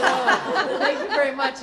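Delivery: loud, high, effusive mock thanks; hammed-up delight. Thanking officials for an unsuitable tie, hammed-up delight. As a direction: gush over it, much too brightly.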